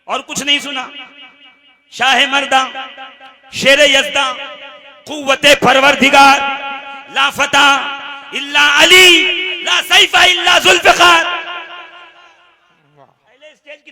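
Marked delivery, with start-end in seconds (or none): 1.9-11.2 loud, building declamation, phrase by phrase; rousing sermon oratory. Acted. Preacher hails Ali's titles to the congregation, full-force declamation. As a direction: proclaim each title with rising force.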